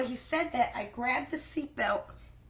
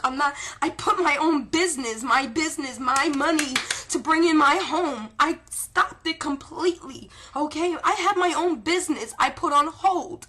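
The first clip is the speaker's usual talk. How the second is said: emphatic insistence; 5 semitones higher, 7 dB louder than usual. Real, not acted. Insisting this is real, not a game.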